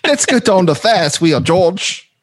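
high-pitched mock English girlfriend; Siobhan Day impression. Co-host teasing George as his English ex, high mock-girl voice. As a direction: coo it in a put-on English girl's voice. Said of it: "I dated an English girl, Siobhan Day".

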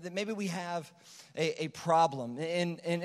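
ordinary talk

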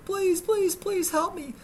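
high, pleading yell; desperate yelling. Retold or imitated. Bitten man in hospital yelling for help, desperate. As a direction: beg for help at the top of the voice. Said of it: "I'm yelling".

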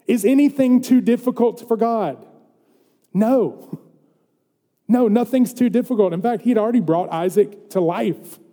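animated pulpit voice; preaching. Real, not acted. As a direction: preach it with rising energy. Preaches with raised voice.

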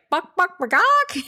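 high, rising cluck of a hen singing the egg song, last "buck" squeaked way up. Retold or imitated. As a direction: imitate a hen's egg song, climbing into a squeak. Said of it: "the sound that chickens make that's like".

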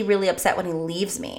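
ordinary talk, nothing marked

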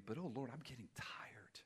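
hushed, low and worn out; acted exhausted sigh of a prayer; weary prayer. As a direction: let a tired plea sink almost to a whisper.

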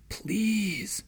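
a high, pleading whisper; whispered please. A narrator's reading. Morse pleads with her as Sarah approaches: high pleading whisper. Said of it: "Morse whispered".

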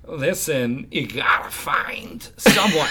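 slow, oddly punctuated delivery; Christopher Walken. Acted. Showing friends the famous actor's odd phrasing, slow Walken impression. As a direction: break the sentence up in strange places. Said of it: "the Christopher Walkens where he's just".

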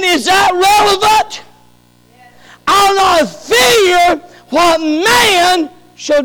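slow, loud, high emphatic preaching; fervent preaching. Acted. Preacher proclaiming the verse slowly and loudly. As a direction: stretch each word of the promise out loud.

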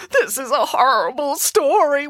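put-on a child's high wail. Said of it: "wailed Boris".

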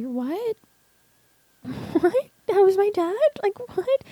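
high-pitched retold shock; stunned disbelief. Daughter learns the babysitter was her dad, stunned disbelief. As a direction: let the voice leap up in disbelief.

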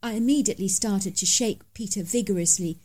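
plain reading voice

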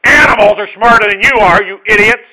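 loud, high, exasperated scolding; God scolding. Pretend. Preacher voicing God scolding his foolish people, loud angry shout. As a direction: shout it like a furious father at fools. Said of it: "He's saying, basically".

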